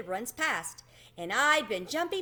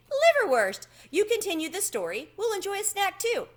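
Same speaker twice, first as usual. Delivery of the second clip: proud high mouse voice, louder on liverwurst; 11 semitones higher, 4 dB louder than usual. A narrator's reading. Tucker proudly drops the meat for Chester, high and proud. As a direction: present the snack with high pride. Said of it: "he said proudly".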